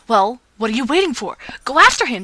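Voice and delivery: loud, pushy urging; yelled. Acted. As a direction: shove him to go, loudly. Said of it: "Tyler yelled".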